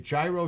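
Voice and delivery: "gyro" with a j sound and long i; New York gyro. Shown as a demonstration. Asking C to back them up, "gyro" as JAI-roh. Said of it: "as I'm pronouncing it".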